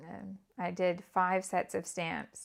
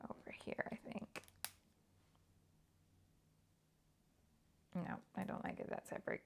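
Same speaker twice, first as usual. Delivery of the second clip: whisper; 17 dB quieter, faster than usual. Real, not acted. Deciding layout, talking to herself.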